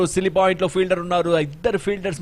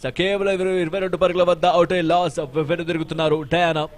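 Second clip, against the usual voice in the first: raised live play-by-play; excited cricket commentary; 5 semitones higher, 5 dB louder than usual. Real, not acted. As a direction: call the wicket with energy. Live wicket call, raised voice.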